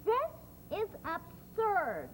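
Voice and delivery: indignant. Acted. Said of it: "the woman said".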